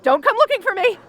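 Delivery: high, tense excuses to slip away; nervous bravado. Acted. Fleeing a distress call nervously.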